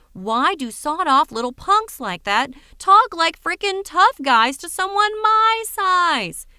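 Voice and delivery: a fed-up big guy venting; indignant rant. Acted. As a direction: stretch it out in disbelief. Ranting about small tough guys.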